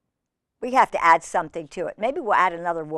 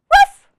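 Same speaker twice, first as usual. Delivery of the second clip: one high, loud woof; single sharp puppy yip; 13 dB louder than usual. Acted. Biscuit answering the reader, one high loud woof. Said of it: "And Biscuit says".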